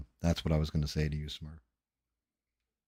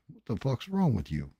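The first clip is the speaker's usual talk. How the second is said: ordinary delivery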